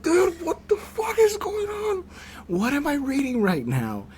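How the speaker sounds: very high, squealed mock disbelief; hammed-up high wail of bafflement; mock bewilderment. Reacting to the Bible rant he was sent, mock bewilderment.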